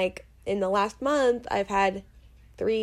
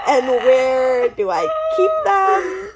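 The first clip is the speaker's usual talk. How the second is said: laughing; 4 semitones higher, 6 dB louder, slower than usual. Real, not acted. Laughing exasperated question.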